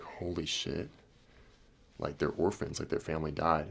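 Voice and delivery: hushed, stunned realization; retold remorse. Man learning the kids are orphans, subdued remorse. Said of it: "went to sadness and remorse".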